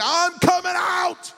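high, slow shout from the back; imitating a waiter's warning shout. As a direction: call it out long so the room hears. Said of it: "all of a sudden I heard it".

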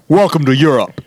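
lightly put-on European accent; mock European boss. Co-host repeating the French boss's line, mock European accent. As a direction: echo the boss with a comic accent.

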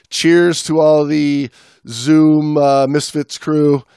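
slow and loud, drawn out cheers; slow toast. Acted. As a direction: raise a glass, draw it out. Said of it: "slow toast to listeners".

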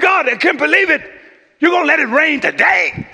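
mock-indignant fuss at the rain; fussing with God. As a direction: grumble at God like a spoiled child. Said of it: "I started fussing with God".

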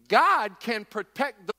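loud, slow call down into the den; the king shouting anxiously into the lion's den, loud and slow. Acted.

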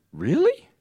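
high, rising disbelief; a stunned question jumping up in pitch; incredulous. Retold or imitated. Team leader to school host, incredulous. Speaker's amazed reply to the offer.

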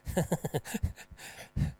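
soft airy chuckle; a little laugh under the breath; quiet breathy laugh. Real, not acted. Laugh after joke.

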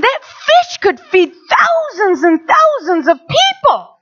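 high, pleading outrage; indignant plea. Retold or imitated. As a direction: push back in disbelief. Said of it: "I said".

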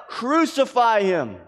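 high, slow crowd cry. Acted. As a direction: cry it out as the mob, drawn out. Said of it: "They cried out".

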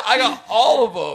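high, fast indignant protest; suddenly heated. Real, not acted. Player contests the tie score, heated. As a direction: burst out quickly, pitch jumping up. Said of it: "you got really heated really fast".

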